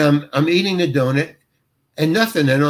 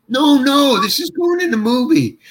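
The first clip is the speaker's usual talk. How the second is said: high, emphatic retold protest; excited overruling; 9 semitones higher than usual. Director answers actor's apology, excitedly insistent. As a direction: imitate a director waving off the worry, pitched right up.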